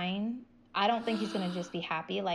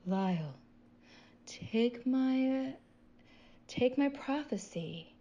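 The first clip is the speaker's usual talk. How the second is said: lightly voiced creepy prophet, higher; creepy cult leader voice; 4 semitones higher than usual. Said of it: "'cause he talks like this".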